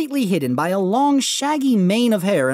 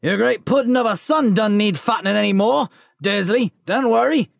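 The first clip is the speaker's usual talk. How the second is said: dark chuckling giant; 4 semitones higher, 3 dB louder than usual. Acted. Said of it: "chuckled darkly".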